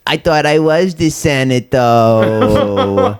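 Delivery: slow, drawn-out whiny Emperor voice; a jokey, whiny Palpatine send-up. Retold or imitated. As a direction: whine it like a petulant villain. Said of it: "imagining him being kinda whiny".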